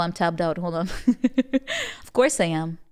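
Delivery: usual speaking voice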